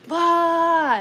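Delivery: sudden high, loud "bah"; loud blurted bah. Acted. Someone blurting at the careful querent, loud mock outburst. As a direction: burst out with a mock yell.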